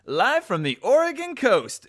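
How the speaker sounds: high, loud opening call; mock-grand TV announcement; live show announcer. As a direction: belt out the location like a host.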